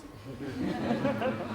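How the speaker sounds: high smug chuckle; mocking laugh. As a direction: laugh down at her. Condescending laugh.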